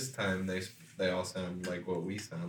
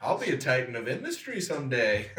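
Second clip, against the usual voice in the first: put-on scrappy kid voice; 7 semitones higher than usual. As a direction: boast like a plucky urchin. Voicing a smart poor kid.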